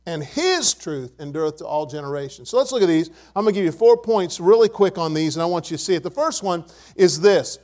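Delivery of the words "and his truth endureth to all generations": his picked out, the rest falling away; stress on his. Shown as a demonstration. Preacher reading the psalm to congregation, his emphasized. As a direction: punch his, then read on. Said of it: "I'm gonna emphasize the word his".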